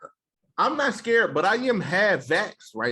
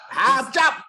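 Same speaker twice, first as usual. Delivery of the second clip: much higher, louder mock Japanese accent; 13 semitones higher, 6 dB louder than usual. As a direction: retell the high school nickname in a put-on Japanese accent. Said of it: "We used to say it like that".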